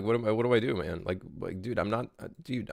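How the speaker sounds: ordinary talk, nothing marked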